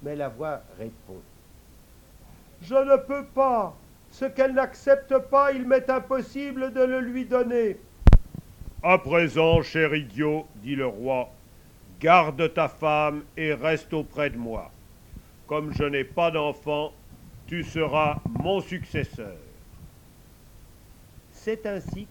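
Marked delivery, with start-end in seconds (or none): 2.6-7.8 high, apologetic magic voice; a high airy genie declining; high spirit voice. Acted. The magic voice answers. 8.8-19.4 king voice. Acted. King speaks graciously.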